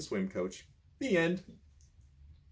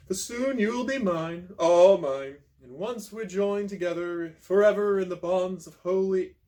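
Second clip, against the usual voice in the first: a hint of a slow, syrupy villain suitor; smooth suitor; 5 semitones higher, 4 dB louder than usual. Guy courting Marion through the door, lightly voiced smooth villain. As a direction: ooze it out like a creepy admirer. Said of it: "he says in a smooth voice".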